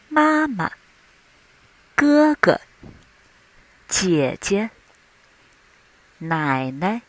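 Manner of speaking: second syllable of 妈妈, 哥哥, 姐姐 and the other words short and light; neutral tone. Shown as a demonstration. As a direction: lengthen the first syllable of words like 爸爸, let the second drop lightly. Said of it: "the last syllable is short and light".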